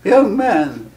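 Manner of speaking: very high, loud and strained, with odd wailing intonation; deaf man's voice. Retold or imitated. Imitating the deaf hypnotist greeting a young student, high and wailing. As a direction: mimic a stone-deaf man who can't hear his own pitch. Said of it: "he had a very strange intonation to his voice".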